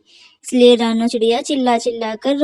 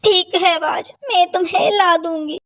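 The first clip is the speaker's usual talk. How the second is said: higher, crying agreement; tearful mother crow; 6 semitones higher than usual. Acted. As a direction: give in while weeping. Mother agrees through tears. (रोती हुई ऊँची आवाज़ में हामी)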